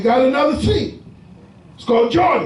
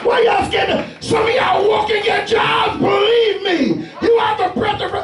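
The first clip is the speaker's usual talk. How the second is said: raised, driving preacher's voice; preaching at full force; 7 semitones higher than usual. Acted. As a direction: preach it out to the congregation.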